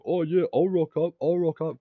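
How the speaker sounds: high, slow, loud jeering; mocking taunt. Tyson bragging he'll turn up, mimicked high and slow. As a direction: parody his bragging. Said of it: "he's like".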